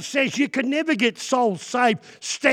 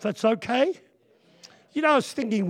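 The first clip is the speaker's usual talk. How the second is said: ordinary delivery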